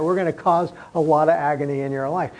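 ordinary delivery

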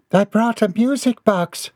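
high, falsetto mother's voice; proud mother. A narrator's reading. Mother displays her find to son, high proud voice. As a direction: announce it in a high, pleased voice.

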